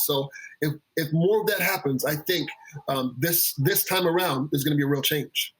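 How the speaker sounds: ordinary talk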